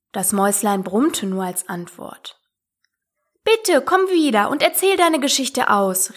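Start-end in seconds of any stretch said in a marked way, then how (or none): 3.4-6.1 higher, lightly called plea; calling after. A narrator's reading. Alice calling after the departing mouse, pleading. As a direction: beg someone leaving to come back. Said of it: "rief Alice ihr nach".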